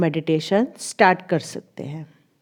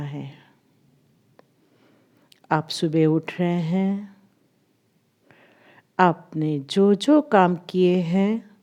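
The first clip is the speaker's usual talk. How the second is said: guided meditation; 2 semitones lower than usual. Acted. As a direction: ease them into stillness, drawing out the English cues. (धीमी, ठहरी हुई ध्यान कराने वाली आवाज़)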